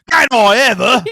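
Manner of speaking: loud, high, mock Cockney yelp; worst Cockney accent. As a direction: blurt it in an awful Cockney accent. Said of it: "Can you do just the worst Cockney accent ever".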